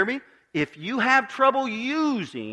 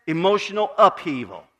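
ordinary delivery

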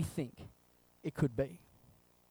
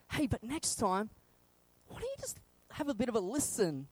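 gentle chiding; 3 semitones higher, 4 dB quieter than usual. Acted. God gently chiding after comforting.

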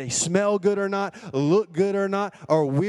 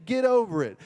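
high, emphatic pulpit exhortation; forceful preaching; 5 semitones higher, 3 dB louder than usual. Real, not acted. Preacher urging congregation to forgive like Jesus, forceful exhortation. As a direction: drive it home in a raised preacher's pitch.

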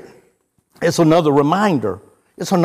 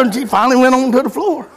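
higher, laughing retelling; laughing while telling; 6 semitones higher than usual. Real, not acted. Preacher telling church about old lady, laughing. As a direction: giggle through the story.

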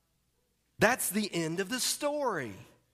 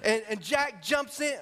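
ordinary talk, nothing marked

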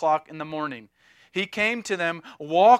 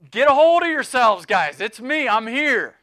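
loud casual bro voice telling the guys to toughen up; brash buddy yelling at scared friends; Jesus as a blunt modern friend, loud and casual; modern slang Jesus; 5 semitones higher, 7 dB louder than usual. Retold or imitated. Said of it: "in modern English".

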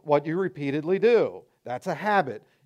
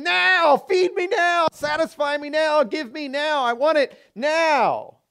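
higher, louder, insistent "now"; demanding flesh; 9 semitones higher, 4 dB louder, slower than usual. Retold or imitated. Preacher voicing the flesh screaming at you, loud high demands. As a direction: demand it like a spoiled child. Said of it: "desires of our flesh that are screaming".

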